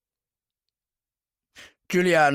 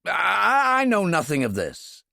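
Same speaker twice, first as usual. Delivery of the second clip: blustering; 9 semitones higher, 4 dB louder than usual. Acted. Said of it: "tried to bluster".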